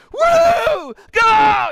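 high, loud scream at the top of the lungs; top-of-the-lungs sideline scream; screaming fan. Retold or imitated. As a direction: scream your fool head off. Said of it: "Screaming my fool head off".

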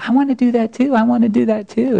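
put-on high, eager child begging; high kid voice pleading to join in; little girl's voice. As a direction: pipe up like an excited six-year-old. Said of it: "and Maddie's like".

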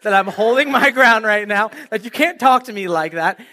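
forceful, insistent retelling; firm and loud. Retold or imitated. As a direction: make it clear nobody talks to you like that. Stands his ground in a confrontation.

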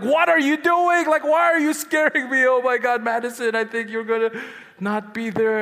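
put-on high, loud frantic pleading to the baby; a scared dad crying out to his unborn daughter; panicked crying out. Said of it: "and I'm crying out".

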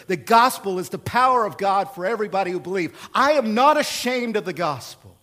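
emphatic, swelling pulpit declaration; forceful sermon climax; impassioned preaching. Real, not acted. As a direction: proclaim it boldly, building to a loud peak.

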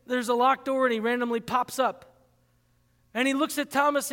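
ordinary talk, nothing marked

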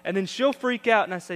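ordinary delivery

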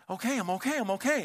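put-on a kid gasping that he's fine; shaken kid. Kid reassuring himself after jump.

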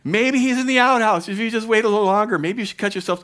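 high, loud, fast taunt; mocking jeer. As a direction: rub it in with glee. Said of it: "He's just mocking them".

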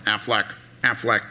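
a light duck-like squawk of the brand name; a hint of a duck squawking the slogan; Aflac duck. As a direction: quack the name like the ad's duck. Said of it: "this duck in the background".

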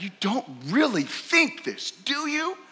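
father's incredulous scolding; emphatic scorn. Acted. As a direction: tear into his arrogance. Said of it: "this is emphatic".

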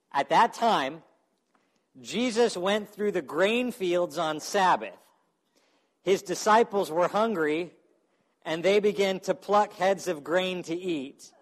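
Scripture reading. Acted. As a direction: read it out to the room. Reads Scripture aloud.

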